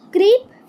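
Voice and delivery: Creep said loud and high as its own beat; syllable clap demo. Shown as a demonstration. Teacher to pupils, showing how to clap creep loudly. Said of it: "हमें कैसे बोलना है फिर इसे".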